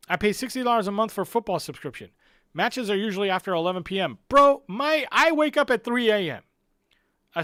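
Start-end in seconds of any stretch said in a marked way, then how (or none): 4.3-6.4 exasperated outburst. Real, not acted. Venting about early kickoffs.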